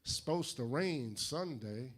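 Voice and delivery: drawn-out Southern small talk; slow, friendly Southerner. Retold or imitated. Georgia clerk making weather small talk to an impatient customer, very slow. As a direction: stretch it out like an unhurried Georgia clerk. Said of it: "They're slow, but they're friendly".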